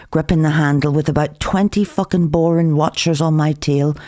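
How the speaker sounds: ordinary delivery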